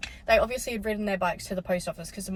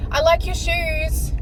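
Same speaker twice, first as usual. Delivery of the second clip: high, drawn-out mock compliment; acted-out friendly stranger; 10 semitones higher, 6 dB louder, slower than usual. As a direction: call it out slowly in a higher, imitated voice.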